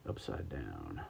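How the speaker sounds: low, quiet spooky murmur. Pretend. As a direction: drop the voice low and eerie. Stranger Things name, dropped voice.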